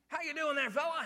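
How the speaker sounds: loud, high cheery hello; chipper greeting. Retold or imitated. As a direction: boom out an overly cheerful greeting. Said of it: "so chipper in the morning".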